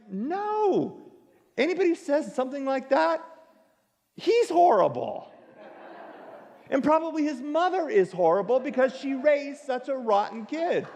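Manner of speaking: high, mock-outraged rant that breaks into a laugh; hammed-up fury at a rude kid; indignant inner rant. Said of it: "And I'm like".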